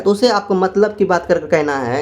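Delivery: normal talking voice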